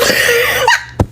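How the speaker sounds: a burst of high, gleeful laughter; squealing real laugh of delight. Real, not acted.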